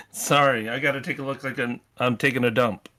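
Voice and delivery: usual speaking voice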